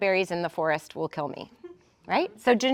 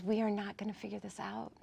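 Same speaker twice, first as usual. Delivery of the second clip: much softer, as if reasoning with yourself; quiet self-talk; 12 dB quieter than usual. Acted. Telling your own brain at three A M, softly. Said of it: "Talk to yourself like that".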